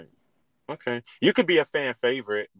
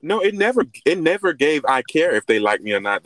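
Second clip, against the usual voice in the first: defensive; 8 dB louder than usual. Real, not acted. Defensive loud reply.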